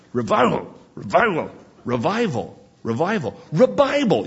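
"read your Bible" blurred until it becomes "revival"; imitating Doctor Ebling. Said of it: "it would sound like revival".

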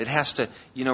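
ordinary talk, nothing marked

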